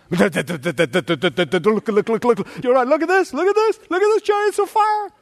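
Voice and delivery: stammering then shouting high with wonder; babbling excitedly. Retold or imitated. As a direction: babble in awe, rising to a high cry. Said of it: "this young man was babbling".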